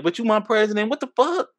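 high, stunned disbelief; mock outrage at the president; incredulous quote. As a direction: pitch jumps up in shock. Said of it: "I'm like".

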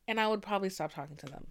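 ordinary talk, nothing marked

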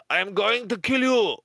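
heavy mock French accent, high; hammed-up French death threat; Peter. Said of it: "in his heavy French accent".

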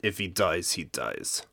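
put-on low, deep Russian accent. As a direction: growl it like a Russian boxer. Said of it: "Only in a Russian accent".

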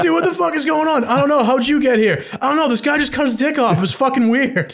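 high-pitched, baffled retelling; mock bewilderment. Acting out baffled kids' panicked exchange.

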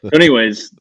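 normal talking voice

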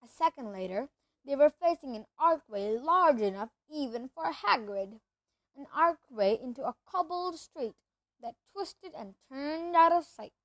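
ordinary delivery

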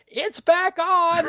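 high, drawn-out call from the other room; hollering. Retold or imitated. Someone yelling that the show is back on, drawn-out holler. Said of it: "someone was hollering from the living room".

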